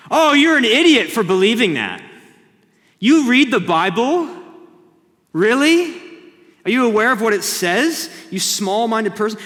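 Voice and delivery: loud, high scornful voice; mocking unbeliever. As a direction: scoff at the believer.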